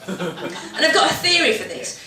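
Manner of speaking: normal talking voice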